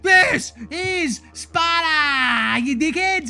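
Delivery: mock battle-cry yell; yelling movie quote. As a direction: bellow it like a Spartan king. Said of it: "fucking yelling".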